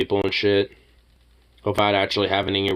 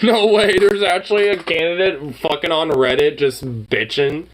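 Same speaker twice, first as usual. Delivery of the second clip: high, loud disbelief building to a squeal; rising shock at a ridiculous post; incredulous outburst; 10 semitones higher, 5 dB louder than usual. Real, not acted. Reacting to a candidate posting on Reddit, shrieking in disbelief.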